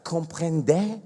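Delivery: quiet, slow, baffled disbelief; incredulous. Retold or imitated. Comic reacting to the tennis player's French, quiet and stunned. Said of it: "Et je suis like".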